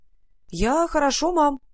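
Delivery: high, loud, cheery reassurance; upbeat, drawn-out child's answer; cheerful. A narrator's reading. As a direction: chirp it brightly to reassure mum. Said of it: "сказала Варя бодрым голосом".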